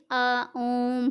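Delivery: आ and ओम drawn right out; extra-long vowels. Shown as a demonstration. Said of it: "दीर्घ स्वरों से भी अधिक समय".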